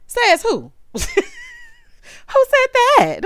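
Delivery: squealing high, laughing and outraged; high-pitched laughing outrage; laughing disbelief. Real, not acted. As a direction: shriek it out like you can't believe what you heard. Outraged disbelief at character's line.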